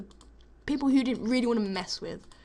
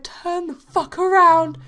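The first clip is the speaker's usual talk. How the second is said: loud, slow, higher demand; angry order; 8 semitones higher, 10 dB louder, slower than usual. Retold or imitated. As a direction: bark it at her. Friend orders victim angrily.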